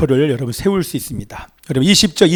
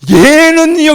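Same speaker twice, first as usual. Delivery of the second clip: Peter's question; 10 semitones higher, 5 dB louder, much slower than usual. Acted. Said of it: "주님이 지금 마무리하려는데".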